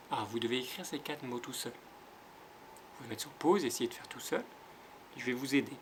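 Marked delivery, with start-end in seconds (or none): none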